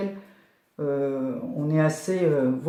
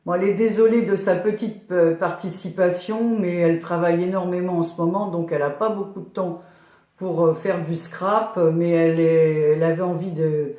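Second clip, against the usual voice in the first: ordinary talk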